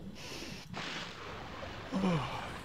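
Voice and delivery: a long, tired yawn. Real, not acted. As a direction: let out a big yawn. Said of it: "my apologies for the yawn".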